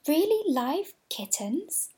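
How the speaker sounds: high, slow, rising disbelief; amazed question. A narrator's reading. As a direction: ask it wide-eyed and amazed. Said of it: "cried all the dolls".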